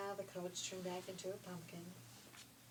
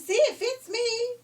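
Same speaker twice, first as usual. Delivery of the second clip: very high, loud slow squeal; shrieking; 17 dB louder, slower than usual. Acted. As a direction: squeal it in triumph. Youngest sister squeals.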